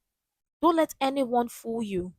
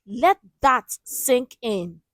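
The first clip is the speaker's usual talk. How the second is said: slower, weightier repeat; slower than usual. Real, not acted. Host letting the anthem line land, slow and heavy. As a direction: drag out "Let that sink in." slow and heavy.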